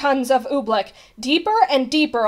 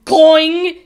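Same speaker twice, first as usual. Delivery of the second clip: loud, high elastic twang; springy boing; 6 semitones higher, 12 dB louder than usual. A narrator's reading. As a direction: imitate stretched rubber snapping back. Narrator imitates springy snap.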